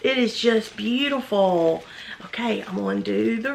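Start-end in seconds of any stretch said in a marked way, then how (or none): 2.3-3.5 soft whisper while working. Real, not acted. Untying a ribbon on camera, half to herself, whispering. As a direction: whisper it to yourself.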